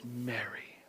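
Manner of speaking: low, whispered, intimate call; tender naming. Acted. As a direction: breathe the name gently to someone loved. Jesus calls her by name.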